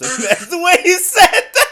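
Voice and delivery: loud, high, through laughter; real helpless laughter in the voice; cracking up. Real, not acted. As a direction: try to talk while laughing hard. Still cracking up.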